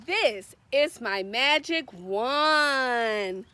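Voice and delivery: usual speaking voice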